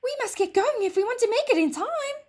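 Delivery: high, loud teddy bear voice. A narrator's reading. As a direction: hurry everyone as a fussing teddy.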